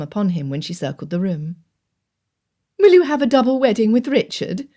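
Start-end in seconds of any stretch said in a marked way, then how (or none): 2.8-4.7 put-on higher, louder voice of an older aunt; aunt asking her nephew; aunt's eager question. A narrator's reading. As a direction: voice the aunt with bright curiosity. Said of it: "had been her first question".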